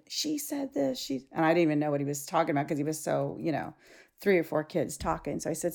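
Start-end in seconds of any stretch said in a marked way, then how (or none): 0.1-1.3 higher, softer imitation of an upset student; a hint of a complaining kid. Student telling teacher what happened, mildly upset kid voice. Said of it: "he's all upset. He's like".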